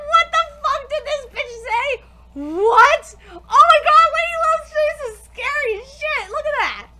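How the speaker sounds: loud, high, laughing shock; stunned crowd. Retold or imitated. Everyone gaping at the girl's insult, loud laughing shock. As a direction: shout it out in giddy disbelief. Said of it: "everyone's like".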